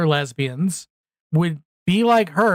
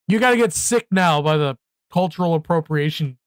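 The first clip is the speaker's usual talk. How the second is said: normal talking voice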